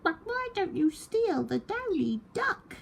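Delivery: very high clucking hen; high fussy hen. A narrator's reading. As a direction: sell out the duck in a hen's voice. Hen pleads.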